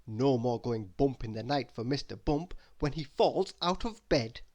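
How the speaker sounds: ordinary delivery, nothing marked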